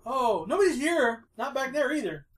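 Scooter, high and nervous. Acted. Scooter lies to the cops at the door, nervously. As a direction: keep it high, badly hiding something. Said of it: "louder and louder and higher and higher voices".

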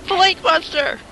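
alarmed shout. Acted. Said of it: "and I say".

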